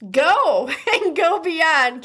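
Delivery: slow, laughing through the words. Real, not acted. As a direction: giggle through the sign-off. Laughs while flubbing the outro.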